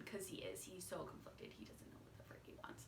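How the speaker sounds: fast, breathless whisper; whispered aside. Real, not acted. Vlogger confiding to viewers about the conflicted man, whispering. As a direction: hush it out quickly like a secret.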